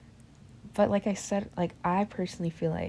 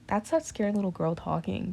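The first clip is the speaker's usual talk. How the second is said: ordinary talk, nothing marked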